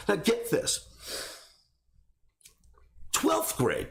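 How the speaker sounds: ordinary talk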